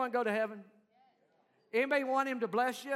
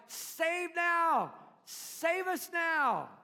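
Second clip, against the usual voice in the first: higher, louder crowd cry, slowing on the last words; crowd pleading; 7 semitones higher, 4 dB louder, much slower than usual. Retold or imitated. Crowd begging Jesus for rescue, raised and drawn out. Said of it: "these people are like".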